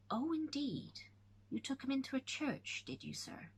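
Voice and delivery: high then hushed, knowing; sly, pointed questioning; wary servant. Acted. Patten's wary reply.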